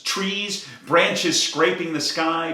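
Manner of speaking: usual speaking voice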